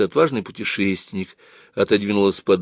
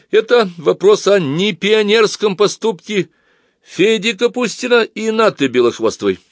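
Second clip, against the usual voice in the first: торжественно провозглашает; 12 semitones higher, 5 dB louder than usual. A narrator's reading. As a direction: open the meeting like a pompous chairman. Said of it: "уверенным громким голосом". (торжественно, громко, с расстановкой)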